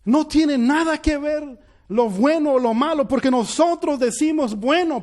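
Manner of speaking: impassioned sermon climax; fervent preaching. Acted. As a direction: preach it to the congregation with fire. Preaching at full force.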